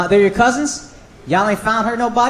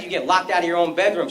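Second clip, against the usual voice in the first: ordinary talk, nothing marked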